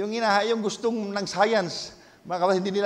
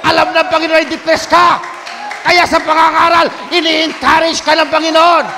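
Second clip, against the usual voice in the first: building preacher's fervor, louder; preaching; 9 semitones higher, 14 dB louder than usual. Acted. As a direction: preach it with rising conviction. Preaching at full force.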